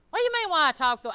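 loud, quick, high-pitched comeback; defensive retort. Retold or imitated. Preacher re-enacts answering critics of his tone, defensive retort. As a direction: fire back as if offended, re-enacting it. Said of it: "It's your tone".

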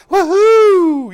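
high, loud celebratory woohoo; high joyful woohoo in church; joyful whoop. Shown as a demonstration. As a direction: whoop it out like praise. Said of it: "why don't you go".

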